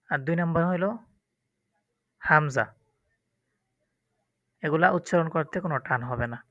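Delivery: ordinary delivery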